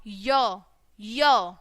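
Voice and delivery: य said low and short, twice; teacher drilling the Y sound as य; phonics sound. Shown as a demonstration. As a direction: sound out य for the children. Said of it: "क्या बोलेंगे इसको हम".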